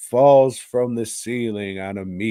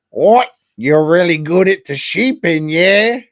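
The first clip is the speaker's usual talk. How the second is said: much higher and louder, a hammed-up proud father; mock loud dad; 8 semitones higher, 10 dB louder than usual. The dad cheering his son on with a hammed-up proud bellow. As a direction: belt it out like a cartoon farmer dad.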